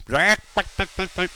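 mock chicken squawk then clucks; quick, staccato play-clucking. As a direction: cluck playfully like a hen. Imitates chickens on the air.

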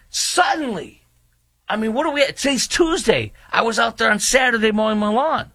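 incredulous, pitched high and sharp; indignant high rant. Real, not acted. Host venting to his audience, incredulous and high.

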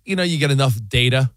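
ordinary talk

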